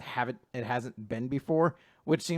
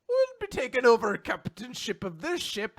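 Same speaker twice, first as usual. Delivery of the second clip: higher, lightly Irish captain; 9 semitones higher, 3 dB louder than usual. Pretend. Captain claiming the ship in a touch of Irish. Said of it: "a stereotypical Irish guy".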